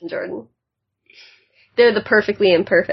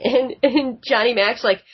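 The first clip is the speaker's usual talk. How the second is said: ordinary talk, nothing marked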